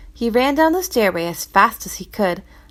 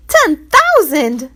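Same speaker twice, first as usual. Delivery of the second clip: Ten thousand, much higher, slow gasp; shocked crowd; 7 semitones higher, 8 dB louder, much slower than usual. Acted. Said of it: "exclaimed everyone in the crowd".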